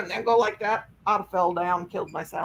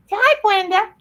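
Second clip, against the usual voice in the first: much higher, louder coaxing; pet voice; 12 semitones higher, 7 dB louder than usual. Real, not acted. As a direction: coax the dog to say hi. Prompting dog to greet.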